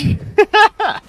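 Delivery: a burst of high-pitched laughter at his own bit. Real, not acted.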